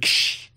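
short hissed crash noise; quick unpitched "ksh" of shattering glass; imitated glass smash. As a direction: mouth the sound of breaking glass. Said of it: "and I heard".

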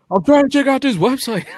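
high, loud put-on hunter voice; bounty hunter voice. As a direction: squeal it out as a wild bounty hunter. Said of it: "that's her hunter voice".

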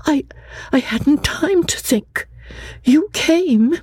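put-on high, earnest young woman's voice; young Lucy. Lucy answering her cousin Charlotte, earnest and high.

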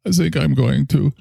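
put-on a drunk German doctor praising his schnapps; heavy German accent, tipsy. Character continues.

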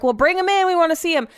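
high, eager call to bring him in; eager judges. Retold or imitated. Judges asking for the grandpa, eagerly high-pitched.

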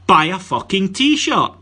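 deadpan punchy sales pitch, slow and loud; blunt slogan. Shown as a demonstration. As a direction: bark it out slowly like a cheeky ad tagline. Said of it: "the advertising slogan I've come up with for this is".

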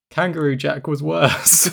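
slow, breaking into laughter; cracking up. Real, not acted. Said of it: "You could hear me kind of cracking".

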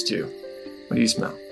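ordinary delivery

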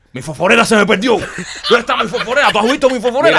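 high, loud, panicky hunter voice; frantic search. Retold or imitated. Hunter in the tent wakes his friend, panicking loudly over his lighter. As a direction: bluster about the lost lighter in the dark.

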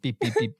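quiet imitated beeps; soft mock beeping. Soft beeping, mimicking a failed phaser. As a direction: murmur the beeps like a dying phaser. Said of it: "He was like".

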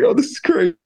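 very high, small-voiced amazement; retold amazed squeal. Guest recalls the star turning the song up, amazed squeal. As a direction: replay the thrill in a tiny high voice. Retells amazement as music gets louder.

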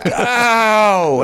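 high laughing shout of oh; laughing realisation. Retold or imitated. Realising the star saw them crying, a high laughing shout. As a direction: burst out with a laughing oh. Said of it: "I went".

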